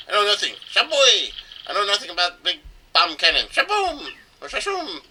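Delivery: a cartoon Russian bomb shouting its explosion noises; mock Russian accent. Mayor Bob-omb denies the cannon in a hammed-up Russian accent. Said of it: "that voice is a bit, sound a bit racist".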